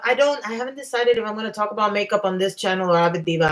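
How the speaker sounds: ordinary talk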